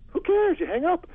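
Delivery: gleeful shrug of a con man; excited. Real, not acted. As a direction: toss it off, pitch jumping way up. Excited rhetorical point.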